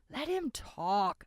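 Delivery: slow, drawn-out words; a lazy drawl. A narrator's reading. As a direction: stretch it out lazily. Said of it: "another voice drawled".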